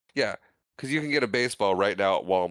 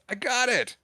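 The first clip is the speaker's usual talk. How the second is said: retold scream; 13 semitones higher than usual. Boy grabbing the baseball, high mock scream. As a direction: imitate a kid's excited scream at normal volume. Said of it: "he grabs the baseball and screams".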